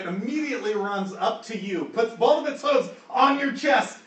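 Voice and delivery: high, loud, urgent storytelling; excited game master narration; animated action narration. A narrator's reading. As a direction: punch out each action as it lands. Game master narrates sheep's sudden assault.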